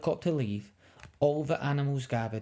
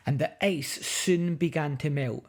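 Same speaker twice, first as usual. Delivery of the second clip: ordinary delivery, nothing marked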